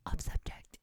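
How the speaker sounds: unvoiced whisper right on the mic. Real, not acted. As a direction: lean in and whisper it. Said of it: "You're really into, like, whispering into the microphone".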